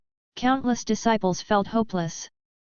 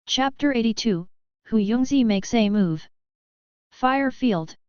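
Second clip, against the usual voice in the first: usual speaking voice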